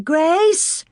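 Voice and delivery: high, loud calls from the doorway; calling. A narrator's reading. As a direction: call her name out wide.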